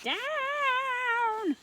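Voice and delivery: high, loud shout down; witch's cry. A narrator's reading. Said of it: "cried the witch".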